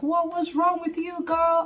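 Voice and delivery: high-pitched mock telling-off of oneself; incredulous self-scolding. Speaker looks back at her own breakdown, incredulous self-scolding. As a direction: scold yourself in high-voiced disbelief.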